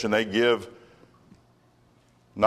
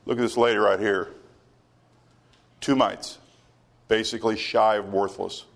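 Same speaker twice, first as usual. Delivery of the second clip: normal talking voice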